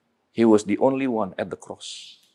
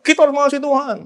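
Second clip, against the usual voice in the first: much higher mock yell of the slogan; mock shouting; 3 dB louder, faster than usual. Preacher mocking believers who yell slogans, mock yell. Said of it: "kita teriak-teriak".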